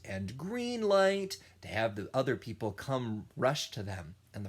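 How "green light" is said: called out. Shown as a demonstration. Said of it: "the person shouts out".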